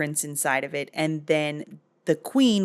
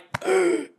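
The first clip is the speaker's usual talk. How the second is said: mock gasp. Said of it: "the king's like".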